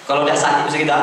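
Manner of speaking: normal talking voice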